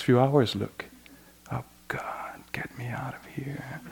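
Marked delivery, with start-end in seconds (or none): 1.5-3.8 whispered, wanting out; desperate whisper. Retold or imitated. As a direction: breathe it like a trapped retreatant. Despairing meditator wants to escape.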